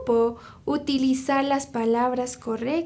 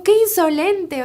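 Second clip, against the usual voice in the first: mild retold outburst, louder and higher; an imitated sultan taking offence; angry; 5 semitones higher, 5 dB louder than usual. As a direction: exclaim in mock indignation, raised pitch and volume. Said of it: "o sea, se enojó".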